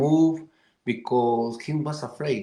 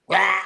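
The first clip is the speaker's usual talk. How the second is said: loud, higher squawking caw; crow caw; 5 semitones higher, 6 dB louder than usual. Acted. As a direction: belt out a crow's caw. Crow opens beak to sing.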